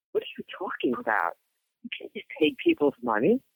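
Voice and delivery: can't believe what the trooper just said; outraged disbelief. Retold or imitated. Mother retelling her protest to the roadside trooper, high indignant disbelief.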